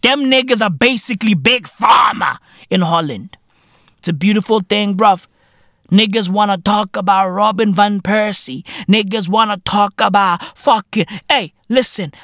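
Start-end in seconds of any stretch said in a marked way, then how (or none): none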